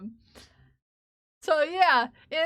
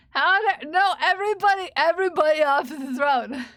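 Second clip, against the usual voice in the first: loud scolding order; raised-voice playful indignation; mock outrage; 2 semitones higher, 6 dB louder than usual. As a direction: shoo the whole crowd off loudly. Shooing everyone off the throne.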